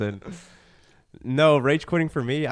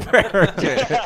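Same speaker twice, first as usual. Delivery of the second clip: higher, louder, slow and laughing; 8 semitones higher, 6 dB louder, slower than usual. Real, not acted. Guest admitting he waits to be interrupted, laughing. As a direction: crack up mid-sentence. Laughing at his own habit.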